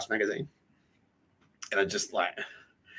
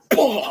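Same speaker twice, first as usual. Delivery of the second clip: mock punch grunt; 13 semitones higher, 8 dB louder than usual. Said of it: "He just went".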